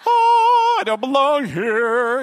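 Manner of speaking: hammed-up German accent, high and loud. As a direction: squeak it out in a mock German accent. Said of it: "I just did a German accent".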